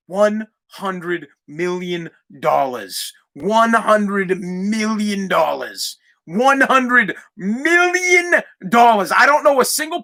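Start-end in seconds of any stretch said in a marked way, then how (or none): 0.1-6.0 slow, low, every word stretched; drawn-out emphasis. Real, not acted. Podcaster spelling out the sum to critics, drawn-out emphasis. 6.2-9.1 "million" stretched long and slow; stress on million. Real, not acted. Podcaster driving the sum home to critics, stress on "million".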